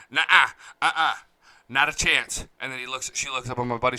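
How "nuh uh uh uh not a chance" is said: a louder put-on auntie voice, "Nuh-uh"; mock sassy refusal. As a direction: shut him down with attitude. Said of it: "And aunt goes".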